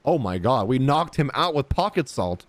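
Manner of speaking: ordinary talk, nothing marked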